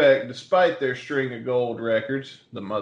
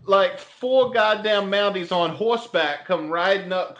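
a higher, hammed-up Boston storyteller; Boston accent; 4 semitones higher than usual. Telling friends the Mountie chase, lively Boston accent. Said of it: "once you get into that Boston accent".

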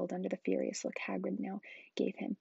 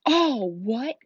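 loud, raised, incredulous roar; thundering Hagrid; 5 semitones higher, 12 dB louder than usual. A narrator's reading. Giant stunned by the boy's question, thundering. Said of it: "Hagrid thundered".

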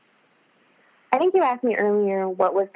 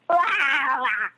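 emotional noises; 7 semitones higher than usual. Retold or imitated. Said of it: "made all these, like, weird noises".

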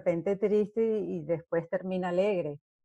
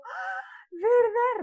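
put-on much higher, slow old granny voice; grandma character; 4 dB louder, much slower than usual. Grandma realizing she skipped a scene, slow high old voice. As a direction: drawl it out like a surprised little granny. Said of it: "Yo hacía de abuelita".

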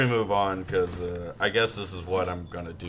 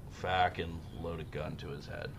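whisper; 5 semitones lower, 12 dB quieter than usual. Real, not acted. Dark aside on erotic asphyxiation.

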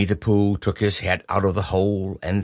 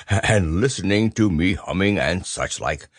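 plain reading voice

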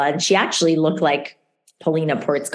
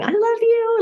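half-joking, pitched up high; kind of joking. Real, not acted. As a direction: say it as a joke. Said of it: "like, kind of joking".

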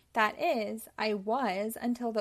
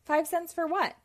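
higher, louder challenge; indignant question; 6 semitones higher, 5 dB louder than usual. Acted. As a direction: ask it as a put-out goat. Goat challenges the toll demand.

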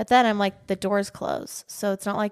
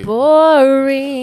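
long, loud, higher "boring"; loud mock heckle; 4 semitones higher, 12 dB louder than usual. Telling co-host her verdict on the game, loud mock heckle. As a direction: draw it out like a heckler.

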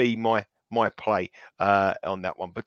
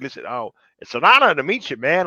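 hammed-up American, higher and louder; American accent; 4 semitones higher, 6 dB louder than usual. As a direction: gush in a put-on American accent. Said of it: "in an American accent".

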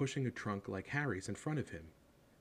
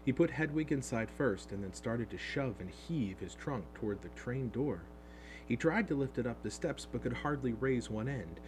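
ordinary delivery

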